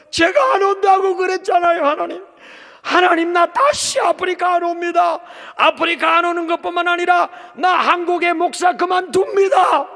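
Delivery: loud, high, indignant shouting at God; angry protest. Retold or imitated. Pastor shouting his protest at God, loud and indignant. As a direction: yell your protest up to heaven. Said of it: "제가 막 의분이 나서 막 항의하고".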